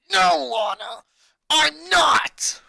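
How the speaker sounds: high, loud, crying shout through gritted teeth; venomous through gritted teeth. Acted. Boy snaps back at his worried sister, furious and tearful. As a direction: spit it out with venom, near tears. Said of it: "his tone laced with venom as he spoke through gritted teeth".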